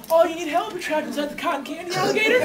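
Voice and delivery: gently cooing offer of help; mock concern. You speak to the figure trapped in candy, mock concern.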